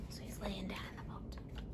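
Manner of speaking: hushed whisper, voice off. Acted. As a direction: whisper so Jesus can sleep. Jesus lies down to sleep.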